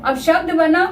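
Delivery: ordinary talk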